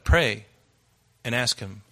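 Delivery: ordinary talk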